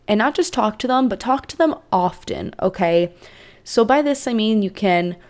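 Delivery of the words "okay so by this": usual speaking voice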